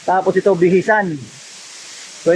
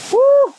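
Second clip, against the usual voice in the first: high, loud nervous whoop; excited whoop; 4 dB louder than usual. Real, not acted. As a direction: let out a thrilled woo. Crossing shaky bridge.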